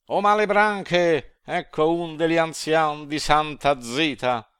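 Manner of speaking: high, loud, snarling devil's cry; demon shouting. A narrator's reading. A demon on the bridge calls to his fellow devils, loud high devil cry. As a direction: bellow it down from the bridge like a gleeful demon. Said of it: "Del nostro ponte disse".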